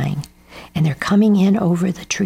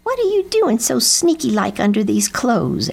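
higher-pitched crow character voice; nosy crow; 5 semitones higher than usual. A narrator's reading. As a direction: question him suspiciously as a cartoon crow. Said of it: "asked Lucy Crow".

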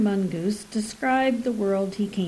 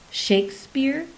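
echoing in surprise; 5 semitones higher than usual. Acted. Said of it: "she echoed".

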